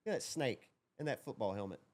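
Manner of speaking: normal talking voice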